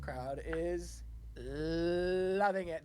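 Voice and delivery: very slow, low build-up; relished verdict. Acted. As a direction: stretch it out for suspense. Announces crowdometer verdict.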